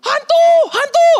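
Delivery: high, loud panicked scream of ghost; terrified shout. Retold or imitated. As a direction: shriek it like sailors seeing a ghost.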